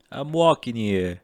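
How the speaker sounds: loud, drawn-out East Coast accent. Shown as a demonstration. Actor warming up for a casting call, East Coast accent. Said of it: "I can do a Boston accent".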